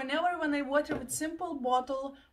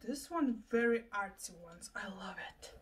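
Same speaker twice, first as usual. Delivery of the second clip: hushed, breathless whisper; awed whisper; 5 semitones lower, 5 dB quieter than usual. Real, not acted. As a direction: whisper it as if you're in awe. Admiring hair clips in awe.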